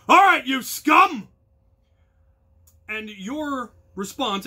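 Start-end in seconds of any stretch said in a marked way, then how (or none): 0.1-1.3 full-volume bellow, drawn out; shouted rally cry. Shown as a demonstration. Captain bellows the rally cry at the company. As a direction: roar it across the field to the crew. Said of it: "will yell as loud as we can".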